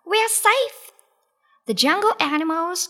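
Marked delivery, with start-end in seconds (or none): 0.1-0.9 high rabbit cry of relief. A narrator's reading. Rabbit rejoices at lion swept away, crying out. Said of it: "cried Clever Rabbit".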